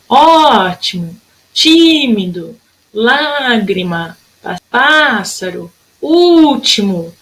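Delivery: long first syllable in "ótimo, tímido, lágrima, pássaro, último"; stressed first syllables. Shown as a demonstration. Teacher listing accented words, stretched first syllable in "ótimo, tímido, lágrima, pássaro, último". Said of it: "pronunciando essa palavra com mais intensidade".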